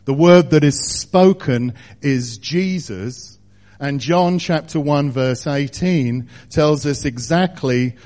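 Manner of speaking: fervent preacher building to a peak; preaching at full force. Acted. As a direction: lift it and press every phrase home. Proclaiming the Word at full force.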